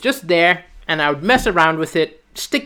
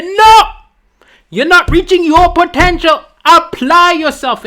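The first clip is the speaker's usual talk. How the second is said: shouted mock father, higher and louder; strict father; 9 semitones higher, 7 dB louder than usual. Father scolding his son over one B. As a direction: yell it like a disappointed dad. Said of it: "you'd say".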